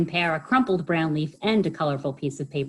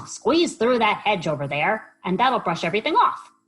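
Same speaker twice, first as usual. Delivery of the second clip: pig voice; 5 semitones higher, 5 dB louder than usual. Acted. Pig's voice.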